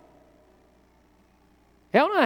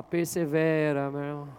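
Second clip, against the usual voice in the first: drawn out, slow and gentle; slow model phrase; 9 semitones lower, 3 dB quieter, slower than usual. Shown as a demonstration. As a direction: stretch out Persevera for them to repeat. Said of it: "dá um sorriso pra quem tá do seu lado e fala". (Persevera dito devagar, bem arrastado, para a igreja repetir)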